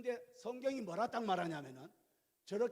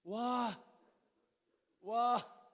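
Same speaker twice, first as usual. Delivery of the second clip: mock amazement; 4 semitones higher, 6 dB louder, much slower than usual.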